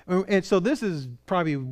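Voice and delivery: ordinary delivery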